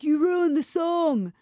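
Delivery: hammed-up grievance from the angry man; imitating angry man. Angry man at the party mocks her for skipping the shot. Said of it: "He was so angry".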